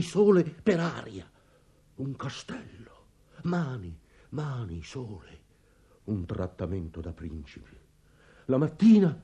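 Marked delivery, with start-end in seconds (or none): 2.0-7.8 very soft, low wonder; hushed awe. Acted. Father recalling the enchanted castle to his household, hushed. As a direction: drop right down, as if seeing it again.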